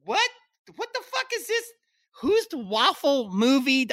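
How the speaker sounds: high-pitched disbelieving squeal, pulled up into a falsetto; stunned, high disbelief at a waffle-rated review; incredulous outburst. Retold or imitated. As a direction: squeak out your outrage at a silly review. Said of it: "And I'm like".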